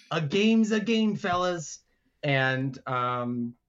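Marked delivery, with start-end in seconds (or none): 0.1-1.8 higher-pitched character voice; Big Notch. Acted. Big Notch to the players, higher character voice. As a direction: concede it in a raised boss voice. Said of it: "Big Notch says".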